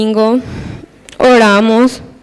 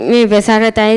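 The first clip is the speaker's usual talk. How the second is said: ordinary delivery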